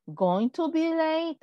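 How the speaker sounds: slow, clear model question; slow model sentence. Shown as a demonstration. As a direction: spell it out slowly for the class. (pregunta modelo, lenta y clara)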